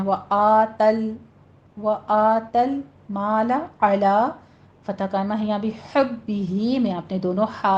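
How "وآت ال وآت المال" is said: slow وآت المال, lam closed without bounce; measured recitation, no echo after lam in وآت المال; clean stop on lam. Shown as a demonstration. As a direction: hold the aa, rest cleanly on l in وآت ال. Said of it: "بس اس کو stop کرنا ہے".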